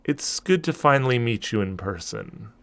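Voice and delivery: a quiet, knowing greeting; smiling spirit. Acted. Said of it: "she smiles down at you and goes".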